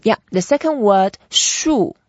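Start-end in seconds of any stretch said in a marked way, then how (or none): 1.3-1.9 "术" starting high and going down; falling fourth tone. Shown as a demonstration. Teacher introduces the second word, "术" in a falling tone. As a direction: drop the pitch through "术". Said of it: "so we go down as well".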